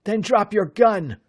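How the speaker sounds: loud, high, fierce demand; snapping. A narrator's reading. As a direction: bark it while pinning him. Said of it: "Bud snapped".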